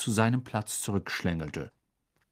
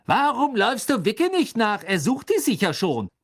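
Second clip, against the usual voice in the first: high, loud jeer; angry taunt; 11 semitones higher, 4 dB louder than usual. Acted. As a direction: goad a friend to leave. Ron taunts Hermine at quarrel's peak.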